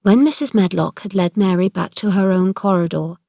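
ordinary delivery

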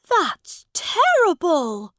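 put-on high, loud, slow young prince voice; the prince appalled by the story; lofty child dismay; shocked boy king. A narrator's reading. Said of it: "said Edward".

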